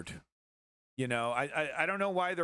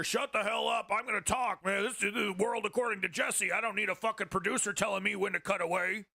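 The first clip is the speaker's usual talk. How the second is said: higher, brash voice brushing off his producer; Jesse Ventura snapping back; 5 semitones higher than usual. Retold or imitated. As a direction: talk over the producer, cocky and defiant. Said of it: "producer yelling in his ear".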